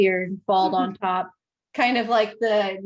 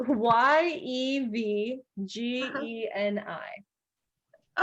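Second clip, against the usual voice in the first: slow, letter-by-letter spelling; 3 semitones higher, much slower than usual. Shown as a demonstration. Game master spells the name for a player, slowly letter by letter. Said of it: "Can you spell that?".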